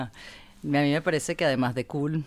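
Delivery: usual speaking voice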